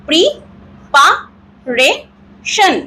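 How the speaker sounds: Preparation as pri, pa, re, shan with pauses; four slow separate beats on preparation; broken into four syllables. Shown as a demonstration. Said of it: "এইভাবে যদি আমরা". (Preparation চার ভাগে ভেঙে ভেঙে)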